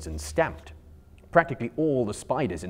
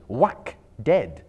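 punchy; 3 semitones higher, 7 dB louder than usual. A narrator's reading. Impact words in action.

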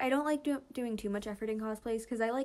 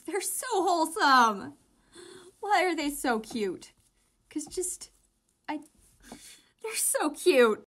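gushing; 5 semitones higher, 7 dB louder than usual. Real, not acted. Gushing over a ship.

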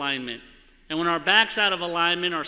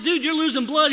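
urgent alarm; 10 semitones higher, 6 dB louder than usual. Retold or imitated. Urging the injured friend.

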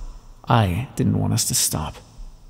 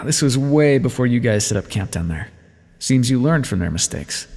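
ordinary talk

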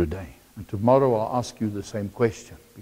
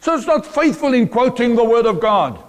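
high-pitched, forceful sermon delivery; impassioned preaching; 12 semitones higher, 11 dB louder than usual. Real, not acted. Preacher warning the congregation that knowledge can destroy, impassioned preaching. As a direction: preach it hard in long, raised phrases.

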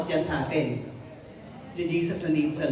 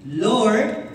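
calling out; 2 semitones higher, 9 dB louder than usual. Acted. Peter voiced calling to Jesus.

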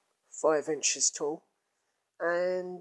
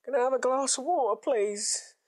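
shouting out; 7 semitones higher than usual. Retold or imitated. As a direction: blurt it out across the room. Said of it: "He just shouts out".

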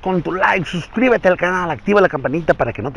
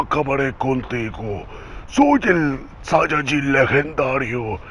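Broly voice; 2 semitones lower, 8 dB quieter than usual. Acted. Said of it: "voz de ... Broly".

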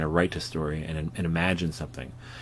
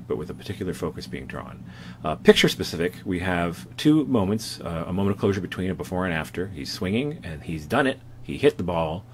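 normal talking voice

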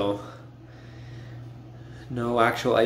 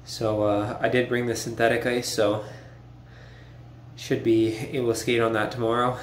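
normal talking voice

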